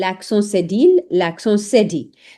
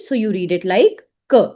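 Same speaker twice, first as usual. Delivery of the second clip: ordinary delivery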